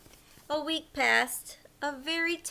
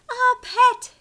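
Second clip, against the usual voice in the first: high, loud joyful exclaim; a fish thrilled to have a pet; excited cry; 11 semitones higher, 12 dB louder than usual. Acted. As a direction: shout it out with delight.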